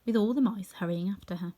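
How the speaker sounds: plain reading voice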